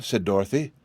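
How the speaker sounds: ordinary delivery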